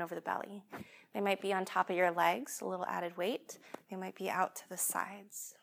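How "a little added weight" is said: normal talking voice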